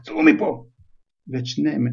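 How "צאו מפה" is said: high, loud angry yell; furious shout. Acted. Uncle orders both boys out, furious shout. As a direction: throw them out with a roar. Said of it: "הדוד ורנון צעק".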